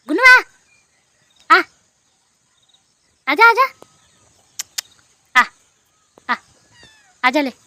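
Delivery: high, loud calls; calling the cat. Real, not acted. Girl calls Gunnu down, loud high calls.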